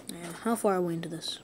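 fast whisper aside; quick whisper. Real, not acted. Streamer checking the time in a whisper.

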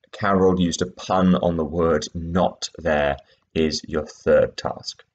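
ordinary talk, nothing marked

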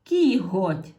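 emphatic teacher "Кіготь"; loud check word. Shown as a demonstration. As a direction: stress "Кіготь" loudly. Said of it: "Змінили слово".

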